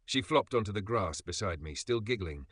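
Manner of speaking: plain reading voice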